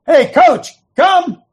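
loud, high summons; calling out. Acted. The Lord summoning the coach, loud calling out.

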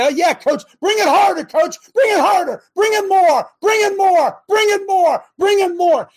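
loud, high, fired-up cheering; roaring for the coach to push harder; shouted fan cheer. Pretend.

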